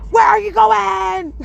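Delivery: slow, high, loud mock shout of "Where are you going?"; mock yell. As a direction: bark it like a furious commenter. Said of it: "yelling at somebody".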